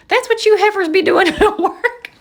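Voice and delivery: high, loud mock scold, laughing through it; imitating the boss. Imagined boss teasing coworkers, high mock scold with laughter. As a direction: ham up the boss's tut-tut.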